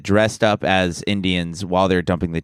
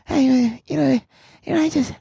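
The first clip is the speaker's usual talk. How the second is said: much higher, rambling Bill Burr voice; Bill Burr impression; 11 semitones higher than usual. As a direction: stammer and never land a word, squeaky. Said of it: "Is that your Bill Burr?".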